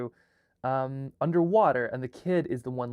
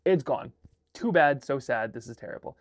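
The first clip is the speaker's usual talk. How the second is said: mock dismissive parent; 3 semitones higher, 6 dB louder than usual. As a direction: play the parent brushing it off with fake sympathy. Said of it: "the parent is like".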